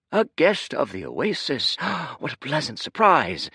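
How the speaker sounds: suddenly all flattery; fawning server. Acted.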